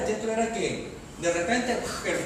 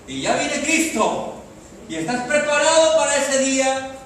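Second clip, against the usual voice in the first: imitated fear-preaching; 8 semitones higher, 8 dB louder than usual. Imitating old preachers warning that Christ is coming, fear-preaching. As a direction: cry it out like an old-time doom preacher. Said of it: "hace años se predicaba mucho".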